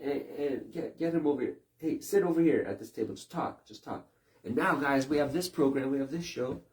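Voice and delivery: high, loud bossy studio voice; hammed-up TV boss calling people in; mock news producer. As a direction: round up anyone to fill airtime. Imitates news producer filling airtime.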